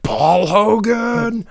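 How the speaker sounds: mock Australian. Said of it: "They're just like".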